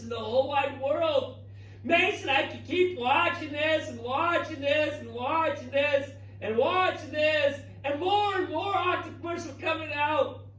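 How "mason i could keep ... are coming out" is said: enthralled. Real, not acted. Enthralled by hatching video.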